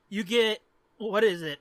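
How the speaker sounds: ordinary talk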